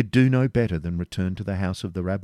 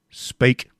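short, loud, higher bark of an order; curt command; 6 semitones higher, 6 dB louder than usual. A narrator's reading. Grand Master ordering the preceptor to speak, curt. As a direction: snap the one word out. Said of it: "said the Grand Master".

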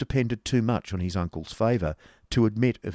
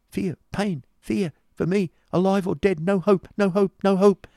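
high, frightened lament, building louder; high-pitched anguish, growing more desperate; shivering, despairing cry; 10 semitones higher, 4 dB louder than usual. A narrator's reading. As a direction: build from fearful pleading to a hopeless wail. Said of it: "cried his companion, shivering".

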